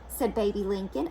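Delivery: usual speaking voice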